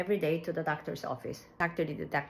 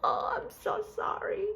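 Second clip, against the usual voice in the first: whispered, crying apology, much higher; tearful apology. Retold or imitated. Mother in labour apologising to the nurse, whispered and tearful. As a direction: sob the apology under your breath. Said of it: "And I said".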